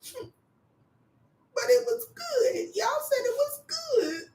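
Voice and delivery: put-on high, soft crying whimper like a sulking child; childish whine. Student sulking to her chair, high soft crying whimper. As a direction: whimper it like a hurt little kid. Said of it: "like a little child in the corner".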